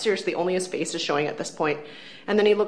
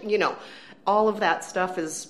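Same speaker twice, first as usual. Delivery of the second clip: ordinary delivery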